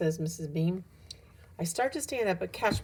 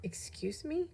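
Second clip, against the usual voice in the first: high, hushed questioning; affronted; 8 semitones higher, 7 dB quieter, slower than usual. A narrator's reading. Missus Beam challenges Joe's refusal, quietly affronted. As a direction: drop the volume, lift the pitch in disbelief.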